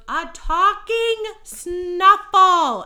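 high, slow, drawn-out shriek; exasperated child yell. Retold or imitated. Little cousin yelling at a confused Santa, slow and shrill. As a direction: yell it at him like a fed-up six-year-old. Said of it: "my cousin goes".

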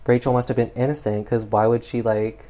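ordinary delivery

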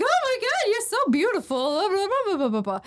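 high, breakneck lovestruck babble; hammed-up high swoon; smitten gushing. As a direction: gush like a besotted cartoon cook. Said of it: "And he's like".

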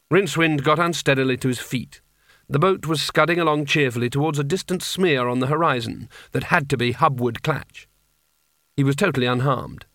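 ordinary delivery

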